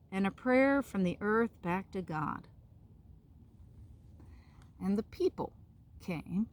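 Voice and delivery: gentle storybook narration; reading to children. A narrator's reading. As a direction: read it out like a bedtime story. Reads picture book to children.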